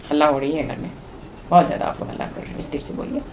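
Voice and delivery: soft, low, fading almost to a whisper; quietly calm down noisy children; hushing gently. Acted. Parent to children making noise at home, gentle hushing.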